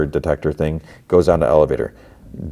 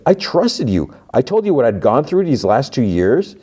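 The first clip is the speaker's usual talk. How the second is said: higher, hammed-up betrayed tone; mock hurt accusation; 7 semitones higher than usual. Mark accusing the woman who lied, mock hurt. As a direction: overplay the betrayal.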